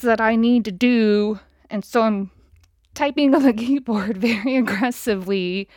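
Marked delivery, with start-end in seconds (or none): none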